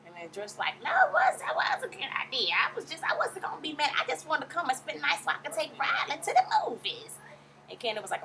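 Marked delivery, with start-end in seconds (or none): 0.8-7.2 put-on high-pitched old lady voice; shrill-old-mother impression, high and indignant; Mama Joyce. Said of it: "That high-pitched old lady-ass voice".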